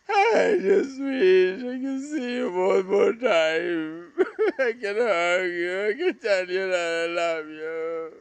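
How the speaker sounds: real tears, voice cracking; choking up while speaking to a lost brother; crying. Real, not acted. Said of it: "this video became so emotional".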